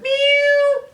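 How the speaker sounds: high, loud cat meow. A narrator's reading. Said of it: "And Kitty said".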